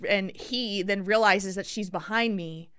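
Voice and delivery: usual speaking voice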